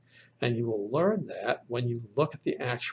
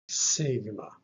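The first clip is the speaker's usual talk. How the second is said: usual speaking voice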